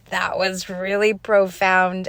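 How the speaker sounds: retold awe, loud and slow; loud, slow, emphatic amazement; stunned amazement. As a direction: draw it out, blown away. Retells own stunned reaction to daughter.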